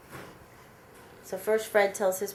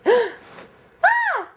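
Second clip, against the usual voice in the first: high loud mock scream of a scared crowd; audience scream; 7 dB louder than usual. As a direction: shriek like a terrified movie audience. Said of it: "hear the whole audience going".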